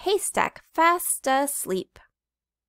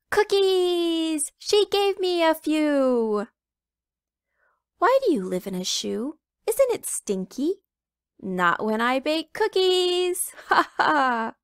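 a cheeky chick hijacking the rhyme; little red chicken; 7 semitones higher, 4 dB louder than usual. Acted. Little chicken butting into the nursery rhyme about cookies, high and gleeful.

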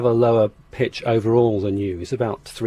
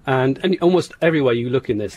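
usual speaking voice

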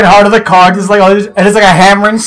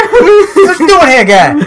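put-on high, drawn-out Boston accent; hammed-up Boston guy, laughing through it; mock confrontation in slow, high Bostonian; Joey Wheeler; 9 semitones higher, 3 dB louder, slower than usual. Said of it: "a Boston version of Joey Wheeler".

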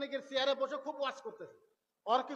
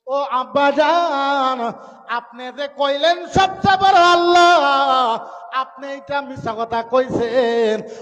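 slow wailing lament; 14 dB louder, much slower than usual. Acted. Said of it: "কানতে কানতে আসছে".

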